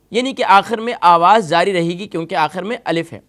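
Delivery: ordinary talk, nothing marked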